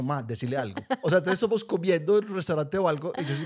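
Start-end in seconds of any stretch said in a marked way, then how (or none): 0.0-0.8 said softly to herself, like an inner plea; a thought kept to herself, hushed. Retold or imitated. Daughter silently begging mom, hushed inner plea. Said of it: "Yo soy por dentro como".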